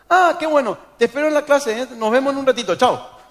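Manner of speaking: despedida aguda y fuerte, falsamente alegre; profesor escapando incómodo. Retold or imitated. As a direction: imita a un profe que se despide para huir. Profesor se despide apurado. (despedida efusiva y apurada, exagerada)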